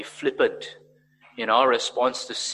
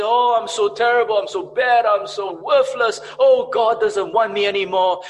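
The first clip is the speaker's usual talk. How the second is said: loud, high mock wail of self-pity; hammed-up misery at raised pitch; miserable lament; 9 semitones higher, 12 dB louder than usual. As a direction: wail it like a despairing sinner. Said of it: "if we just remain miserable in our sin".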